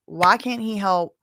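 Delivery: ordinary talk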